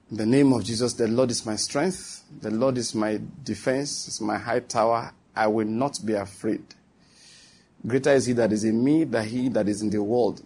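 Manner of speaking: low, soft, steady declaration; calm confession. Acted. Believer calming himself before bad news, quiet confession. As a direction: settle yourself with a quiet prayer.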